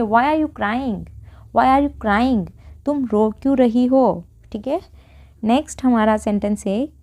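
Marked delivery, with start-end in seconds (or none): none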